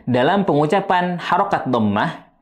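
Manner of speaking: usual speaking voice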